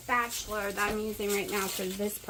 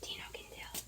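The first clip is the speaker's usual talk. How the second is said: whispering; 16 dB quieter than usual. Real, not acted. Aside to self.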